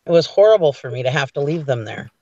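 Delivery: usual speaking voice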